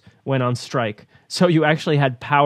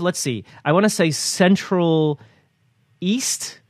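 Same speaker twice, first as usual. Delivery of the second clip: normal talking voice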